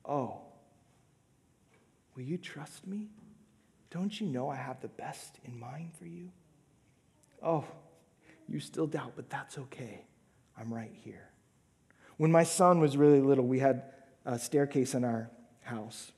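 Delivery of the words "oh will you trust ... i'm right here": soft, hushed, gentle reassurance; tender appeal. Retold or imitated. Jesus inviting a doubter to trust, softly tender. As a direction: murmur it like a loving invitation. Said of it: "an appeal of relationship".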